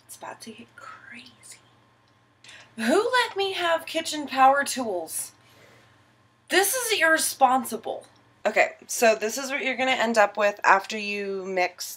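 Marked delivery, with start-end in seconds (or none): 0.1-1.6 conspiratorial whisper; whispering a warning before the mixer. Real, not acted. 2.8-8.1 mock alarm. Real, not acted.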